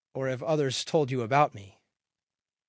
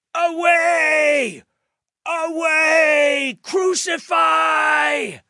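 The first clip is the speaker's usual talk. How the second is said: very high, long drawn-out yells; mob shouting; 11 dB louder, much slower than usual. A narrator's reading. Crowd answering Pilate's presentation, full drawn-out shout. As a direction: scream for it like a baying mob. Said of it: "they shouted".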